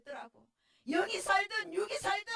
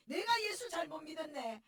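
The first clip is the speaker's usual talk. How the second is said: usual speaking voice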